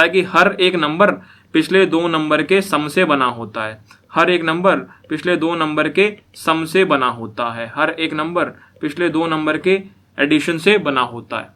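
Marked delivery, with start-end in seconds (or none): 1.5-11.5 slow, spelled-out explanation; slow emphasis. Shown as a demonstration. Teacher slowly stressing the sum rule for students. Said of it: "repeats rule slowly".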